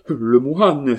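a loud, high cry of recognition; terrified outcry at the monk. A narrator's reading. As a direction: blurt it out in shock. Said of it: "s'écria l'assassin".

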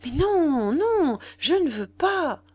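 high, drawn-out refusal; lively protest. A narrator's reading. Mignon objecting to Nana's plan, lively protest. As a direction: protest with stretched, emphatic noes. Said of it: "reprit vivement Mignon".

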